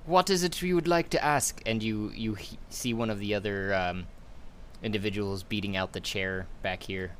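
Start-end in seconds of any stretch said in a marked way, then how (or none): 0.0-1.6 villain voice. Acted. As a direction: speak as a haughty guild master. DM voices Ferris.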